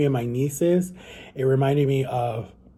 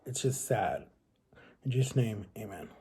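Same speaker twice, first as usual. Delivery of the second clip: prayer; 3 semitones lower, 6 dB quieter than usual. Real, not acted. Closing a spoken prayer.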